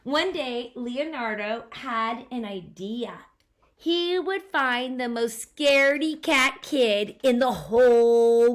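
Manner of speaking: put-on lively read-aloud to a child; storybook narration. A narrator's reading. Parent reading a picture book to a child, animated narration. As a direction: tell the story with bounce.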